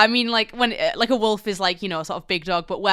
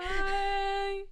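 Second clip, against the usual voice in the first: long, high, sustained farewell; high goodbye; 10 semitones higher, 3 dB quieter than usual. Acted. Last co-host echoing the sign-off, high and drawn out. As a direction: hold one high note on the word.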